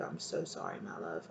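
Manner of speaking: tearful, almost whispered sorry; a real lump in the throat, said barely aloud; near tears, hushed. Real, not acted. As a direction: choke back tears and whisper it. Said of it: "I'm almost feeling like crying".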